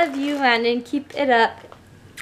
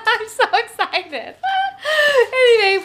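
laughing excitedly; 8 semitones higher than usual. Real, not acted. Laughs while thanking.

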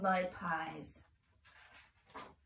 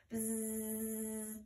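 bee buzz; 9 dB quieter than usual. Shown as a demonstration. Finger play bees come out.

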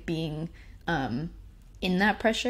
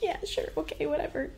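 dismissive; 10 semitones higher, 5 dB quieter than usual. Retold or imitated. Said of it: "And I'm like".

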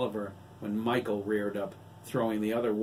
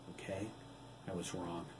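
much softer, lower contrite admission; quiet apology; 3 semitones lower, 12 dB quieter than usual. A narrator's reading. Adam apologizing quietly to Kelly. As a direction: admit it softly, eyes down. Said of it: "lowering his eyes".

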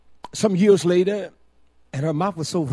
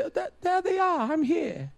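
smug mock remark; 12 semitones higher, 3 dB quieter than usual. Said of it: "you say".

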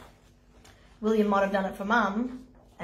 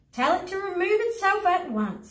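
stern mum; 9 semitones higher than usual. Acted. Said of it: "said Mum".

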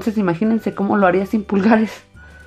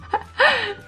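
high giggle. Real, not acted. Struggling without thumbs.

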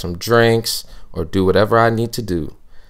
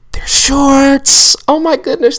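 much higher, louder delighted squeal; excited shriek; 7 dB louder, slower than usual. Acted. Host shrieks that the skirt is really shorts. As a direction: shriek the surprise like a game-show reveal.

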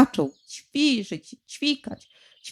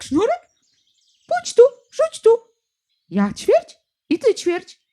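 squabbling birds; 10 semitones higher than usual. A narrator's reading. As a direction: bicker like a flock of birds. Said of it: "wszystkie ptaki ... o taki".

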